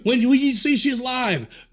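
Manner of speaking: normal talking voice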